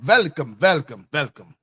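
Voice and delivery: playful mock Russian accent with a V for the W; joking Russian accent greeting. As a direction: welcome them like a hammed-up Russian host. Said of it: "let me say it in my Russian accent".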